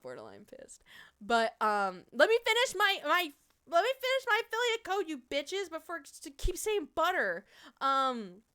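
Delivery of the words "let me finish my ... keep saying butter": much higher and louder, play-scolding chat; mock indignant. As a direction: pretend to snap at the chat. Mock-scolding chat mid-plug.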